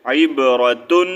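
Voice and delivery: slow عبرتن with TUN sounded; full tun ending. Shown as a demonstration. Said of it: "عبرتن ہی پڑھیں گے". (عبرتن آہستہ، تن کے ساتھ)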